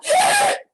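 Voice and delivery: big high hiccup gasp; loud hiccup. A narrator's reading. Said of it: "hiccuped louder than before".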